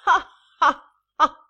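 high, very loud "ha, ha, ha"; scornful laugh. Acted. Mistress laughing off a threat of court, scornful. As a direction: laugh loudly in her face.

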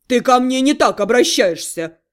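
petulant complaint in a demon voice; capricious demon. A narrator's reading. Supreme demon correcting how he is addressed, petulant. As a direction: grumble like an offended deity. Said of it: "капризно сказал самый высший демон".

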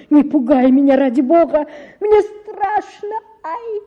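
испуганная мольба, всё выше, до вскрика; умоляет сквозь слёзы, срываясь на высокий вскрик; плач от страха. A narrator's reading. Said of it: "Мой плач поразил его". (девочка в слезах умоляет отца не пугать её)

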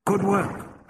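out of breath after the fight; panting. Acted. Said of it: "Hrostar panted".

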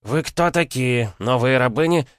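high, pompous dismissal; self-important. A narrator's reading. Puffed-up prince ordering strange women out, self-important. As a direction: puff up and shoo them off, drawing out the questions. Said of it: "раздулся от важности Маков".

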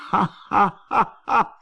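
high storybook ha-ha; acted laughter. A narrator's reading. Kees laughs from the thistle bush, high and mocking.

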